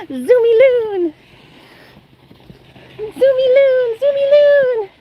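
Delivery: high, loud, drawn-out cheer for the dog; excited cheer. Real, not acted. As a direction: squeal her name with delight as she zooms. Cheering the dog's zoomies.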